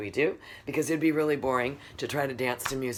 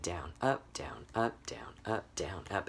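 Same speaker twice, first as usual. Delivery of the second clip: soft, even "down, up" alternation; foot-tap rhythm; 5 semitones lower, 8 dB quieter than usual. Shown as a demonstration. Teacher showing downbeat and upbeat, soft metronomic chant. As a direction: pulse "down, up" like a tapping foot. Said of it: "it's like your foot going".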